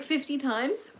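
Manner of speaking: ordinary talk, nothing marked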